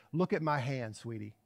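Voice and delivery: soft, low, calming dad; simply said. Retold or imitated. Dad to his panicking daughter in the car, simply and softly. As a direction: drop softly to reassure a child. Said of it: "he just simply said".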